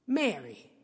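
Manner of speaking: tender. Retold or imitated. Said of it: "Jesus just goes".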